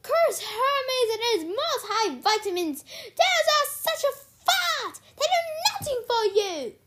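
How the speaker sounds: very high, loud howling complaint; frustrated howl. A narrator's reading. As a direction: howl in frustration, dragging the key words. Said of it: "Sears howled in frustration".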